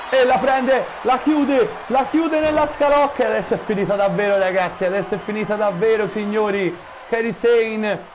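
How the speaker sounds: rising to a frantic climax; breathless italian goal commentary. Real, not acted. Lock applied, excited commentary climax.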